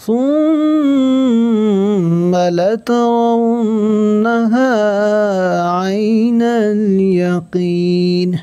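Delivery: slow, high melodic tajweed chant; slow sung Quran recitation; Quranic recitation. Shown as a demonstration. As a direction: draw out each word in recitation. Teacher chants Quran verse.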